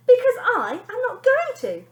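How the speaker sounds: snapped. A narrator's reading. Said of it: "snapped the lady".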